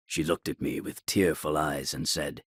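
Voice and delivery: ordinary delivery, nothing marked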